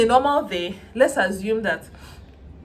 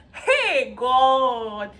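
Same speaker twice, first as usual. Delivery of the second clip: dramatic lament; 4 semitones higher, 4 dB louder than usual. Retold or imitated. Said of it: "I can be very dramatic".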